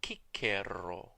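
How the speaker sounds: Cicero as KEE-keh-ro, hard k's; restored Latin pronunciation. Shown as a demonstration. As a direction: pronounce both c's in Cicero as k, with a tapped r. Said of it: "would have pronounced his own name".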